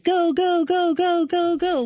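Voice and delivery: high, loud urgent "go go go"; firefighter shouting through mask. Retold or imitated. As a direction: bark orders at the crew. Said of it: "a firefighter's voice being like".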